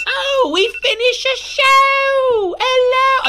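loud, drawn-out British falsetto; Missus Doubtfire. Pretend. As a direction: trill it slowly like a hammed-up British old lady. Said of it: "I just turned into Missus Doubtfire".

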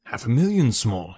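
ordinary delivery

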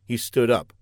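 ordinary delivery, nothing marked

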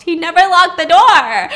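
loud, high burst of disbelief; exclaimed reveal. A narrator's reading. Narrator reveals the twist to a listener, loud exclamation. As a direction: drive it up and land hard on the door.

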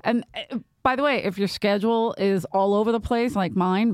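ordinary talk

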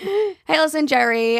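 high, excited greeting; bright read-aloud greeting. Shown as a demonstration. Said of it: "So I'll read the first email".

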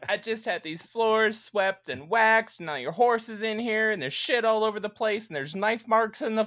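high-pitched comic fussing; exasperated complainer. Retold or imitated. Court official to man on horseback indoors, exasperated mock complaint.

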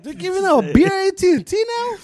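high, loud, laughing mock disbelief; hammed-up shocked bro; incredulous dude. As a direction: imitate the guy losing it over free beer. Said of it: "He's like".

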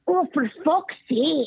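put-on high, slow Scottish toddler exasperation; little Scottish accent. Man quoting a Scottish toddler grabbing the box, Scottish accent. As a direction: sigh it like a fed-up wee Scottish girl. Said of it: "she goes".

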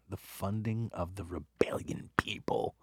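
secretive whisper. Real, not acted. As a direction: hush it like a secret. Conspiratorial aside.

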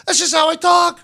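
high-pitched defensive protest; acted-out exasperated retort; indignant self-defence. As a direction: plead innocence, pitched right up. Acted defence against friends' accusation.

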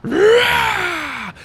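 high, sharp war cry; a sudden attacking roar; high mock battle cry; battle shout. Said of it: "came a shout behind me".

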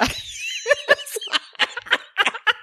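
long airy giggle, high up; wheezing high giggle; high breathy laugh. Real, not acted. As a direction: laugh helplessly, almost silently. Laughs at the scene.

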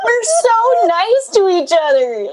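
laughing through the words, slow and high; cracking up while talking. Real, not acted. Joking about mutual compliments.

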